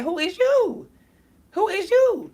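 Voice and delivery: very high-pitched, incredulous questioning; indignant challenge. Real, not acted. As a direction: throw it out as a squeaky scolding question. Challenging people who mistreat others.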